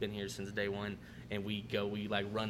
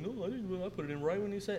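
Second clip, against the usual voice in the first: high-pitched mock protest; mimicked lying denial; 10 semitones higher than usual. Server lies to manager about a late ticket, mimicked denial. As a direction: imitate a defensive server in a high voice. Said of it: "he lied to me".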